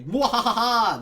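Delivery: loud hammed-up evil laugh; evil villain cackle. Villain laughs after theft.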